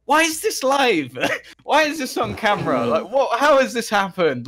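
why is this even live, exasperated; high incredulous cry with a laugh. Retold or imitated. Retelling disbelief on live air.

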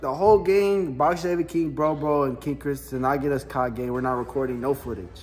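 ordinary delivery

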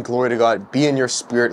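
ordinary talk

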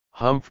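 lightly voiced grumbling humph; a short, high grumbling humph; grumbled humph. A narrator's reading. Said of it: "grumbled the fox".

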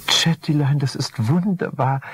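high, delighted wifely voice; beaming wife. Acted. Wife beaming at her husband's news, high and delighted. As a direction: gush it up high with joy. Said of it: "strahlte ihn an".

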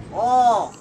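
sudden loud startled cry; startled shout. Real, not acted. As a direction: jump at a scare. Startled by enemy. (깜짝 놀란 외침)

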